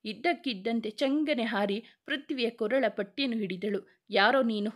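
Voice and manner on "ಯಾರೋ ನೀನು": usual speaking voice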